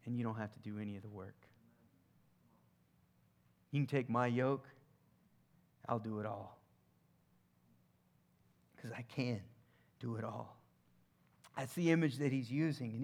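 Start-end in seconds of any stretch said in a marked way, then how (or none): 0.1-10.6 soft, quiet, tender promise; much softer, like a gentle reassurance; quiet voicing of Jesus, reassuring. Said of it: "He's saying".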